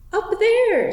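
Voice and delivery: loud, high, stubborn cry; stout. Acted. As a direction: call it out stoutly, pointing up. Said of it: "said Puddleglum, stoutly pointing".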